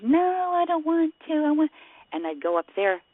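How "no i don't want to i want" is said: high, whiny protest imitating a child; reluctant daughter. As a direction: mimic a child digging in, pitched way up. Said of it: "and, and she".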